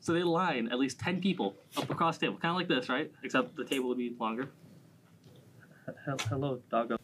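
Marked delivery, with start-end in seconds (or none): 5.9-7.0 turning away from phone. Real, not acted. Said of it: "Sorry, that was my dog".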